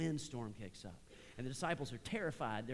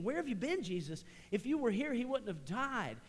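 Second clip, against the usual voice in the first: much higher, louder, a grieving reproach; anguished cry; 9 semitones higher, 5 dB louder than usual. Acted. Martha meets Jesus late after her brother died, anguished and high. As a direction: hurl the question at him in grief.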